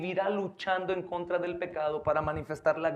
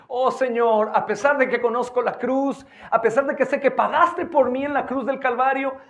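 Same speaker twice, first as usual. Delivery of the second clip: rising, louder preacher's appeal; impassioned sermon climb; preaching at full force; 6 semitones higher, 6 dB louder than usual. Acted. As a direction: preach it with mounting fervor.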